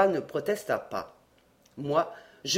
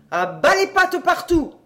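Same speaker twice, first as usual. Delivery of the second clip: stern loud order; 11 semitones higher, 6 dB louder than usual. A narrator's reading. Mother orders family off loot.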